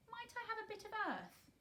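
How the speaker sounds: high, shaky plea; quavering. A narrator's reading. Mary quavers, asking her guardian for earth. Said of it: "quavered Mary".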